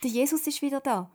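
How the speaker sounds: high, loud, bursting with news; very excited call. A narrator's reading. Papa calling family to see Jesus, very excited. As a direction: urge everyone to come at once. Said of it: "ruft er ganz aufgeregt".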